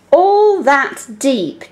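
slow, deliberate line; slow reading. Shown as a demonstration. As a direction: read it slowly for the sound. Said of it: "We just want them to hear those sounds".

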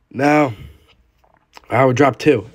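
ordinary delivery

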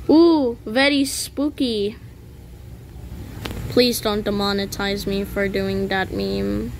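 ordinary delivery

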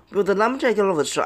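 ordinary talk, nothing marked

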